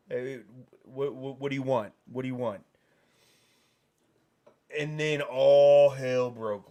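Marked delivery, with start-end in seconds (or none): none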